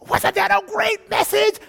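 high, loud hype to the crowd; hyped preacher. Retold or imitated. Pastor firing up the crowd, excited.

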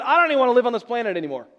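fast, loud, exasperated mock complaint; grumpy Jonah. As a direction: rattle it off like a sulking prophet. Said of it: "Jonah is so grumpy".

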